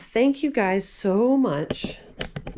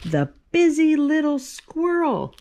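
excited title; 8 semitones higher, 6 dB louder than usual. Acted. Announcing the last book title.

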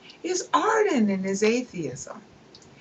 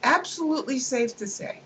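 mock-confident assertion; 7 semitones higher than usual.

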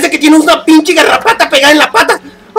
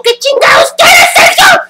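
imitating grandma yelling. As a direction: shriek it like a startled grandma. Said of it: "Mi abuelita gritó".

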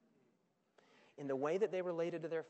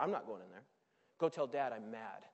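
sulky refusal; 11 semitones lower than usual. Acted. Older brother refusing to join the party, sulking. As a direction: sulk it like a pouty sibling.

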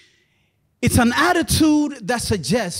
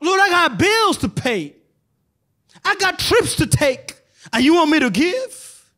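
mock complaint; 6 semitones higher than usual. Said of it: "when God says to you and I".

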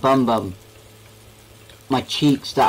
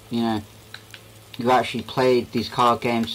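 ordinary talk

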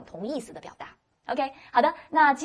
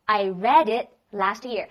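slow, louder, every word clear; slow repeat; 5 dB louder, much slower than usual. Shown as a demonstration. Teacher slowly repeats the answer for the class. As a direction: drill it slowly for the class to copy. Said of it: "然后他说".

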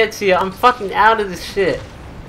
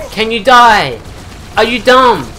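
louder, irritated demand; exasperated shout; 3 semitones higher, 4 dB louder than usual. Real, not acted. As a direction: bark it at an enemy that won't fall. Exasperated repeats at enemy.

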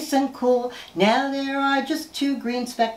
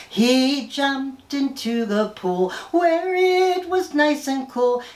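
ordinary delivery

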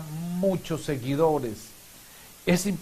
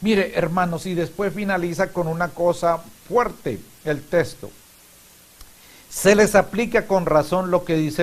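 preaching with force, louder; fervent preaching; 3 semitones higher, 5 dB louder than usual. Real, not acted. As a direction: drive the scripture home loudly. Preacher raises voice on scripture.

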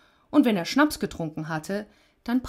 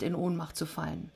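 ordinary delivery, nothing marked

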